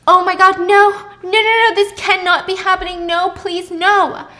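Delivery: loud, high, horrified pleading. Acted. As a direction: beg it not to be happening. Horror seeing binoculars.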